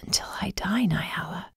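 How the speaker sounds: soft unvoiced whisper. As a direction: breathe it out gently. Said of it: "he whispered".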